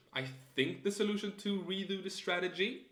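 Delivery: statement ending up on STRATEGY like a question; questioning upward inflection. Shown as a demonstration. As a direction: let "strategy" lift as if unsure. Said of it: "end statements like question".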